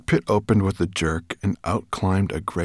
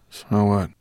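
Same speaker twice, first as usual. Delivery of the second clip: ordinary delivery, nothing marked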